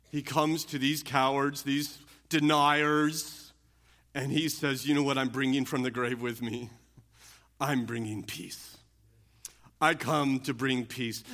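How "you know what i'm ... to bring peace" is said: gentle Jesus voice. Acted. Jesus voiced offering peace.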